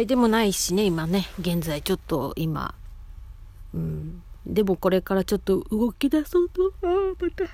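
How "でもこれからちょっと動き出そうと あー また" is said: talking through a yawn. Real, not acted. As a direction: yawn mid-sentence, words stretched open. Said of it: "あくび。".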